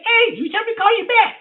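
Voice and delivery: high squeaky voice for the caller; [name]'s voice. Retold or imitated. Retelling the strange caller, high mimicking voice.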